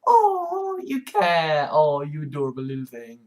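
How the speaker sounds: high cooing voice to a pet; fond high baby talk to a kitten. Pretend. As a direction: coo at the little destroyer like a baby. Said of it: "it's just kind of like".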